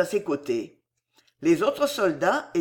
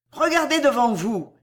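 raised, higher command; calling out; 5 semitones higher, 4 dB louder than usual. A narrator's reading. Officer to his soldiers, calling out. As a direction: call an order to the troops. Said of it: "cria l'officier à ses soldats".

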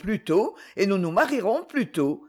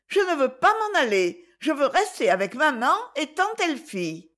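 put-on high, insistent little boy; child refusing; 7 semitones higher than usual. A narrator's reading. Paul refusing to leave his mother, high child's voice.

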